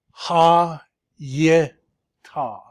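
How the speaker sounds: teacher shows a student the three syllables, pausing between them. Shown as a demonstration. Tutor spells out the syllables to the student, one clear beat each. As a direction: split "ha, ye, ta" into three separate beats with a pause after each. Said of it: "this has to be two vocal syllables".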